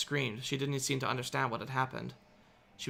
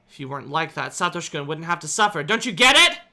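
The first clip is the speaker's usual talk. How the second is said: angry accusation; 4 semitones higher, 11 dB louder than usual. A narrator's reading. Angry girl blaming Satoko. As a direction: let the anger grow to the end. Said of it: "I was too angry".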